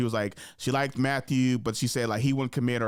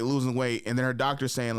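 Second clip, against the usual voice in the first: ordinary talk, nothing marked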